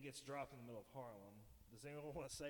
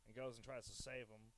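ordinary talk

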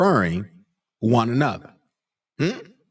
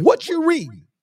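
Philip asking; 12 semitones higher, 5 dB louder than usual. Acted. Philip questions the eunuch.

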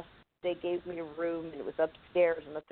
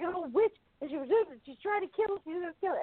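put-on frantic kid; 11 semitones higher, faster than usual. Kid panics to mom.

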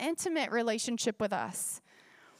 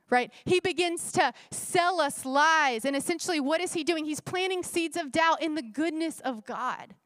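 usual speaking voice

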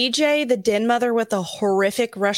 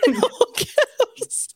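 high, drawn-out words through laughter; laughing; much slower than usual. Real, not acted. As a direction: squeeze the words out while cracking up. Cracking up at own notes.

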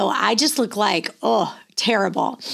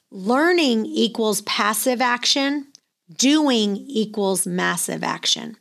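slow, measured delivery for note-takers; slow dictation; slower than usual. Real, not acted. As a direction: spell out each word slowly so they can write it down. Dictating a line to write down.

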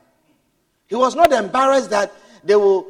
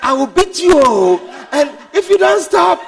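loud, raised scolding; mock angry threat; 8 semitones higher, 7 dB louder than usual. Mother threatening her noisy children at the bank, loudly. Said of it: "mother is speaking her direct to them to calm them down".

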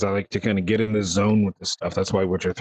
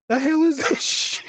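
high, incredulous mock outrage; disbelieving. Player reacts to silly quest, high disbelief. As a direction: sound stunned at the game's silliness.

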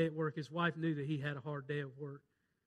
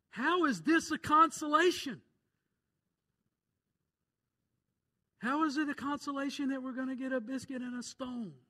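rising preacher; 10 semitones higher, 6 dB louder than usual. Acted. Preacher building question.

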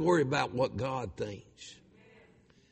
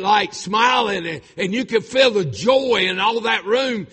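loud, high impassioned storytelling; breathless-with-news urgency at full voice; fervent preacher building the story; excited testimony; 6 semitones higher, 11 dB louder than usual. Real, not acted. Preacher excitedly retells wife's last moments.

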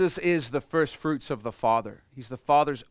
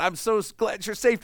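relieved parent; 7 semitones higher than usual. Pretend. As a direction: gush relief at the found child. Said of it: "wanna hug them and smack them".

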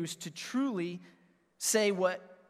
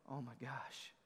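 very soft, low amazement; hushed awe; 6 semitones lower, 16 dB quieter than usual. Retold or imitated. Father moved by hearing Daddy, hushed low awe. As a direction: barely voice it, overwhelmed.

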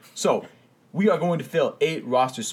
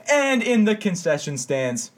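slow, high ring announcer call; mock-grand "concession stands" announcement; 7 semitones higher, slower than usual. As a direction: roll it out like a boxing MC, tongue in cheek. Mock boxing intro for producer.

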